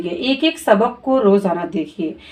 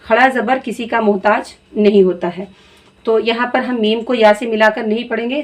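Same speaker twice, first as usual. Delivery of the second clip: ordinary delivery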